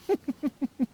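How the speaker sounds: mocking laugh. Scornful laugh at the rival.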